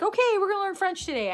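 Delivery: high, quick classroom announcement; imitating a teacher. Teacher announcing a French lesson to kindergarteners, high hurried mock announcement. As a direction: pipe up brightly like a teacher starting a lesson. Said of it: "they don't sit down and be like".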